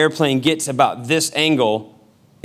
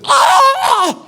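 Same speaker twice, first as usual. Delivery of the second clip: high, loud mock shriek; imitated wife's fright scream; startled scream; 6 dB louder than usual. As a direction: scream as if jumped in a doorway.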